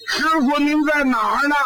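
calling out. Acted. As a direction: shout for the lost master across the cave. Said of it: "猪八戒就喊".

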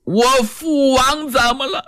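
浑身发抖，结结巴巴，放慢语速; 颤抖着一字一字逼问; 颤声发问. A narrator's reading. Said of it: "罗成浑身颤抖". (罗成颤抖着追问父王之事)

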